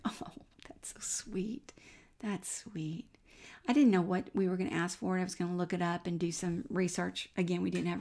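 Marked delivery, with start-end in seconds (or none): 0.1-3.1 soft, slow whispered thanks; touched whisper. Retold or imitated. Answering the daughters' offer to buy the house, soft whisper. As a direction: breathe it out, moved.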